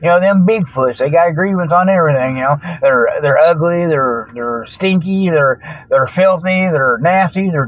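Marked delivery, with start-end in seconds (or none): none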